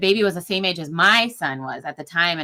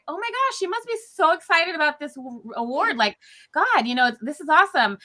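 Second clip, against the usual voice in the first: much higher, bubbly and delighted; gushing excitement; 8 semitones higher than usual. Retold or imitated. Chief nurse thinking the tearful nurse is thrilled, high excited gushing. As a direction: gush like it's wonderful news. Mistaking tears for joy.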